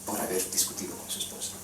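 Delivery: broken whispered confession; humbled whisper. Retold or imitated. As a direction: whisper it, ashamed and bowed. Repentant preacher humbled. (susurrado, con vergüenza ante Dios)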